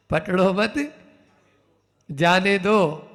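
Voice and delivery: पकड़ो मत joined as one phrase, then जाने दो; group it "पकड़ो मत", pause, "जाने दो"; pause after मत. Shown as a demonstration. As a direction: break after मत, rising on it, then let जाने दो fall. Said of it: "बस इतना ही अंतर है".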